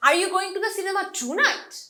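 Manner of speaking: "tonight" punched, rising sharply at the end; stress on TONIGHT. Shown as a demonstration. Teacher asks tonight or another day, stress on "tonight". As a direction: hit "tonight", as in not some other day. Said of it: "আজ রাত যাচ্ছ নাকি অন্য কোনো দিন".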